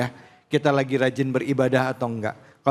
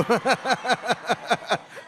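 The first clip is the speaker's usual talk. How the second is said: high light laugh; tawa lepas; 8 semitones higher, 3 dB quieter than usual. Real, not acted. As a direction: laugh freely at the joke. Host laughs at joke. (tertawa lepas)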